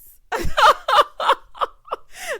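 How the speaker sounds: high, helpless belly laugh; loud cackle. Real, not acted. Host cracking up at her own retelling, cackling.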